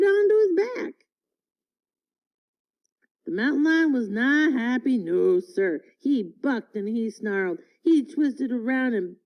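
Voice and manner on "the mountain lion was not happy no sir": ordinary delivery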